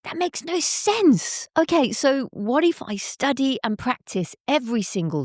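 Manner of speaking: high-pitched, exasperated student protest; frustrated. A narrator's reading. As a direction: push back in frustration, pitch jumping up. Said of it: "Super frustrated, the student replied".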